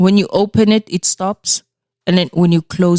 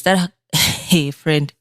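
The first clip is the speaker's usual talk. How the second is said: usual speaking voice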